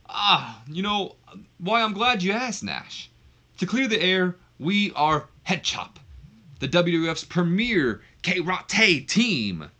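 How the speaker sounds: high, loud wrestling promo; Al Snow. Acted. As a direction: announce it grandly, stretching the big words. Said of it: "that's when Al Snow takes the mic".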